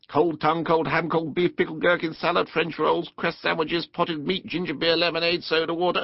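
high monotone, all in one run; rattled-off list. A narrator's reading. Rat lists the picnic to Mole, rattling it off. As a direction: reel off the items without a break.

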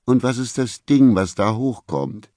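high-pitched woman's challenge; suspicious, high-voiced accusation; accusing interrogator. Vicky's accusing question in quarrel.

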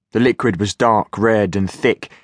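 usual speaking voice

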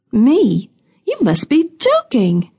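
high-pitched, affronted disbelief; incredulous. A narrator's reading. Woman asked her whereabouts by the constable, incredulous. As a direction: voice leaps up in mild outrage.

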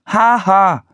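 high, spoken "ha ha"; mock laugh. A narrator's reading. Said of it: "machte Anne". (hohes, gespieltes Haha)

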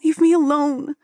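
high-pitched, upset plea; tearful. A narrator's reading. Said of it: "the tears became all too real".

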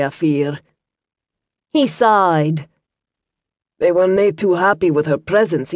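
light Scottish burr, calm and kindly; Scottish monk. Acted. Brother Matthew explains to Liam in a mild Scottish accent. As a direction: explain gently as a Scots monk.

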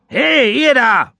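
high, drawn-out sailor's hail; calling out. Acted. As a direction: hail the man with the barrow. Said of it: "rief er dem Mann zu".

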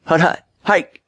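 high-pitched quarterback cadence; mock screamed snap count. A narrator's reading. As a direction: call out the snap count sharply. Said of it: "he screamed".

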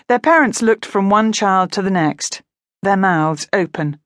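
ordinary delivery

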